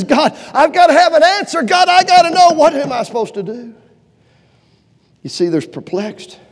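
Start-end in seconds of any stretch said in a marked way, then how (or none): 0.0-3.7 loud, insistent plea; urgent demanding prayer. Retold or imitated. Elijah on his third prayer demanding an answer, loud. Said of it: "goes back a third time and says".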